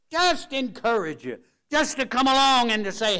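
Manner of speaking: high, loud pulpit exhortation, easing briefly between bursts; fervent preaching. Real, not acted. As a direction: lift the voice and preach encouragement hard.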